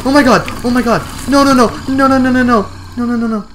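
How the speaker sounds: high, loud frantic screams of no; panicked screaming. Real, not acted. Gamer as the flame ogre breaks into her cave, terrified screaming. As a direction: scream in real terror.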